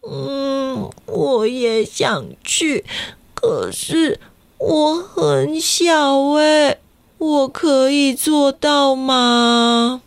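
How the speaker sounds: put-on slow, small unsure child voice; timid child. A narrator's reading. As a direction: wonder aloud like a timid little kid. Small Kakayi doubting himself, slowly.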